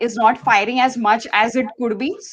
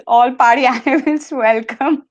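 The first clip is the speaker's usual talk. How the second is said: reading the sign while laughing; slower than usual. Real, not acted. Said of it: "You read that out in a Birmingham accent".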